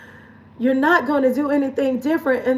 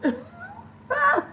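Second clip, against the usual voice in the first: tearful laugh; 3 dB quieter than usual. Real, not acted. Crying laugh over screen time.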